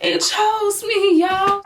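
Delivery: high, drawn-out mock disbelief; hammed-up wonder; amazed. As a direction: stretch it slowly up high, thrilled to be picked. Acts amazement at being chosen.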